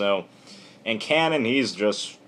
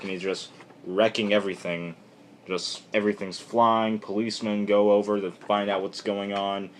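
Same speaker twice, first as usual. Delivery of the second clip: ordinary delivery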